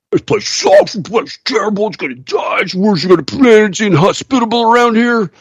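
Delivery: high, whiny mockery of the complainers; mock whiny complaint. Mocking whiny quitters' complaints.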